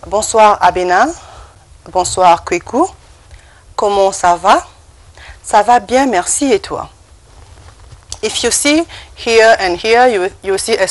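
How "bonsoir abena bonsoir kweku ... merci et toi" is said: loud, careful French, "Comment ça va" rising at the end; pronunciation model. Shown as a demonstration. Teacher modelling evening greetings to repeat, pronunciation model. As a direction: project "Bonsoir" clearly, pausing between phrases. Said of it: "You can just repeat after me".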